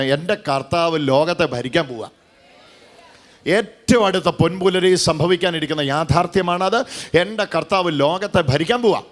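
fervent preacher, rising and falling; preaching. Acted. Preacher proclaiming to the congregation, fervent sermon.

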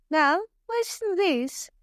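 high, slow showman's question; showing off. Acted. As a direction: present it like a proud showman. Bob shows the villagers.

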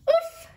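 high, loud cartoon oof; sudden acted grunt of collapse; squeaked-out storybook oof; effortful oof. Monkey topples under the weights.

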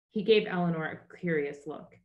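plain reading voice

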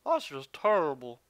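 lightly voiced, slow Barkley drawl on terrible; a hint of a TV pundit, stretched and slow; Charles Barkley impression. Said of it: "If I could do my Charles Barkley there".

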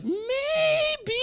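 very high, drawn-out squeak; tempted wavering. Retold or imitated. Comic about to be kissed, high tempted wavering. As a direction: stretch it out way up high.

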